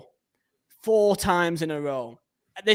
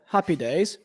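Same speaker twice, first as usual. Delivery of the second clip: ordinary delivery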